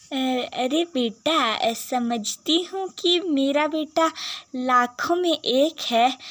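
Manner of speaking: usual speaking voice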